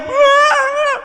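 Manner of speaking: put-on very high, loud children's yell; mock shrieking children; screaming kids.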